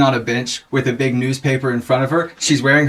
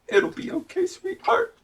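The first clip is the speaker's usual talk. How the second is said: very high, soft cooing; tiny, high motherly murmur; comforting mom; 6 dB quieter than usual. Acted. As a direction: coo reassurance to your child. Mother comforting her daughter.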